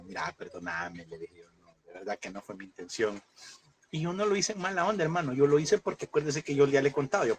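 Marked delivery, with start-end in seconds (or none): 0.0-3.2 near-tearful apology. Retold or imitated. Preacher retelling his apology to a friend, almost crying. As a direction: beg pardon softly, almost crying. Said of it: "yo vi casi chillando".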